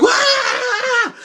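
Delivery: very high mock shriek; hammed-up high scream of fright; startled scream. Startled by the talking orb, mock scream.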